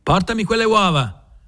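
high barked command to the cook; shouted order. A narrator's reading. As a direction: call out for the eggs. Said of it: "gridò a Damiana". (ordine gridato, acuto)

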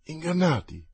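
high, choked cry of disbelief; choked exclamation. Acted. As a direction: gasp it out, stunned and choking. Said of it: "esclamò Enrichetta poco meno che soffocata". (esclamazione soffocata, incredula)

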